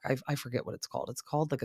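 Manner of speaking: ordinary delivery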